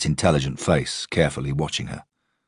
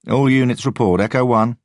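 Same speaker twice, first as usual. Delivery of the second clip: radio roll call; 9 semitones higher, 6 dB louder than usual. A narrator's reading.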